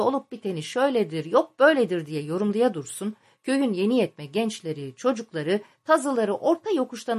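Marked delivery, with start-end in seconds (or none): none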